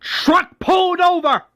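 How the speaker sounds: high-pitched acted fury; angry yelling. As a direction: bark frantic orders as an irate boss. Governor screams orders from car.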